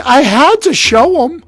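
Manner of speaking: high, loud, stretched-out insistence; insistent protest. Retold or imitated. Player justifying the fight to his girlfriend, insistent. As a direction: justify yourself with acted desperation.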